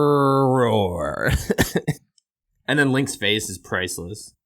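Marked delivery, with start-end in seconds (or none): 0.0-1.3 monster roar imitation; rolled-r dragon roar; growl. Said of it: "I love how he starts".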